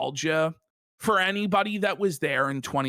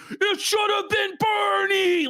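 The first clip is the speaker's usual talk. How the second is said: high, drawn-out mock wail; imitated screaming meltdown; 12 semitones higher than usual. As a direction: howl it slowly in exaggerated despair. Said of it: "Joe fucking just screaming".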